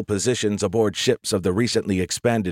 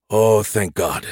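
ordinary delivery

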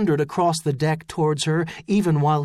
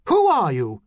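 sudden shouted challenge; 3 semitones higher, 5 dB louder than usual. A narrator's reading. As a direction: shout it out as an abrupt demand. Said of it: "she shouted suddenly".

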